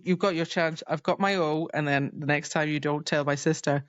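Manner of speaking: usual speaking voice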